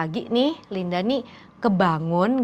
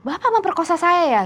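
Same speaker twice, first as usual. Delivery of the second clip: high accusing question; 9 semitones higher, 3 dB louder than usual. Retold or imitated. Linda confronts Romi.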